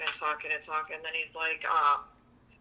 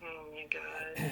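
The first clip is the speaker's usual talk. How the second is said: breathless whisper; whispered oh my god; 4 semitones lower, 12 dB quieter, much slower than usual. Real, not acted. Reacting to a friend's crude joke, whispered. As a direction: whisper it, aghast.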